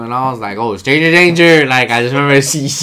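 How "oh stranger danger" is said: loud, slow, drawn-out alarm call; retold yell. Man retelling himself at six warning off a stranger, loud drawn-out yell.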